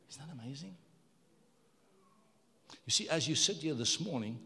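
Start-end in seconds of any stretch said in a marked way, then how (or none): 0.1-0.8 hushed whisper of wonder. Real, not acted. Preacher marvelling to the congregation in a whisper. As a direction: lean in and whisper it.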